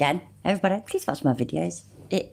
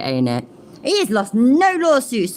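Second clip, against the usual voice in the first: ordinary talk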